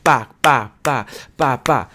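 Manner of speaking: separate, even "ba" beats; one punchy "ba" per beat; beats demo. Shown as a demonstration. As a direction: tap out "ba, ba, ba" like a drum. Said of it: "Like beats in music".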